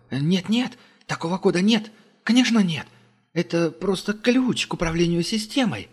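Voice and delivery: high, nervous rushed denial; frightened denial. A narrator's reading. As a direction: panicky captive protesting. Frightened captive denies.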